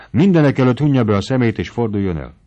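loud, fast order; bossy order. Acted. Mari orders Noszti to shut his eyes, loud and fast. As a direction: snap the order quickly.

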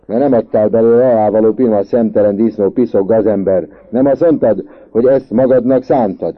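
fast, loud string of insults; high, heated tirade; red-faced shouting. A narrator's reading. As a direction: yell abuse in one furious rush. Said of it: "kiabált kivörösödve Tök Marci".

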